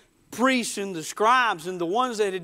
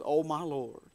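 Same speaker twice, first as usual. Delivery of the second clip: understated, softer lament; 5 semitones lower, 6 dB quieter than usual. Retold or imitated. Peter realizing he has denied his Lord, lamenting. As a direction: quietly grieve a betrayal. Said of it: "Peter laments".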